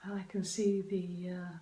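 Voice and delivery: guided meditation. Acted. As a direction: gently guide them into calm. Continues guided inner journey.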